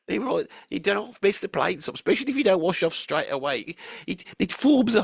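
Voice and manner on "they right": usual speaking voice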